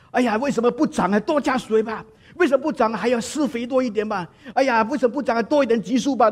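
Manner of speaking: high-pitched mock fretting; hammed-up exasperation, pitched well up; impatient grower. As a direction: complain that nothing is happening fast enough. Acting out impatient grower wanting faster growth.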